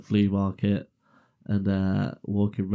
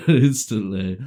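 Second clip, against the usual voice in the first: ordinary delivery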